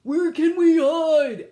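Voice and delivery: high, loud, slow fearful question; squeaky alien in a panic; frightened alien. A narrator's reading. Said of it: "asked Gork".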